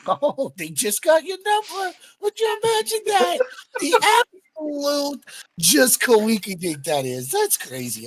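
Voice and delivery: sing-song fake amazement; mock disbelief. Player's character mocking the NPC's story, high and sarcastic.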